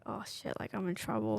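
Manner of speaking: whispered, as if caught out; nervous whisper. Retold or imitated. Called into the boss's office, a nervous whisper. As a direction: hush it like you're in trouble.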